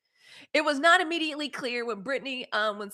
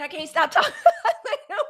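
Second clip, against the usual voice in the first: laughing; 10 semitones higher, 6 dB louder than usual. Retold or imitated. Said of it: "I said".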